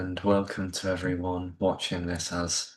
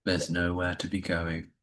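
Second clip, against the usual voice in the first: guided meditation. Acted. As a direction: ease listeners into stillness with gentle pacing. Leads a Yoga Nidra session.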